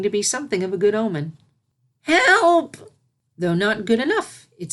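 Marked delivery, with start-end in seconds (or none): 2.0-2.9 high, loud panicked call; cry for help. Acted. Filly sinking in the pond, calling for help loudly. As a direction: shout for help while sinking.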